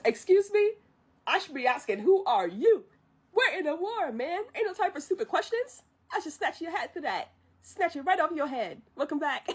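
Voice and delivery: high, cocky bluster; sassy Mushu bluff. Retold or imitated. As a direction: act the fake general telling him off.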